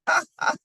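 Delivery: amused laugh. Real, not acted. Reader laughs at story.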